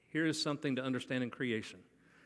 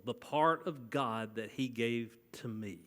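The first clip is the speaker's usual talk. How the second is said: ordinary delivery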